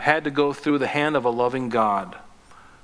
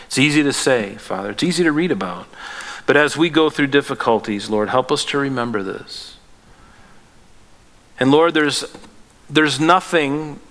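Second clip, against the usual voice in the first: louder, earnest prayer building in force; fervent prayer; 5 dB louder than usual. Real, not acted. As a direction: pray aloud with growing passion. Pastor leads closing prayer.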